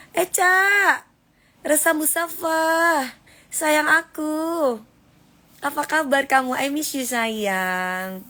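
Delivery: drawn-out, high sweet-talk; affectionate greeting. Real, not acted. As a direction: greet a darling fan, stretching the words. Greets viewers by name with endearments. (sapaan sayang yang mendayu)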